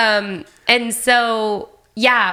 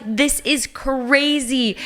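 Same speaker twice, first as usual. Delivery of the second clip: slow amazed; 4 semitones higher, much slower than usual. Retold or imitated. LeBron amazed at photo.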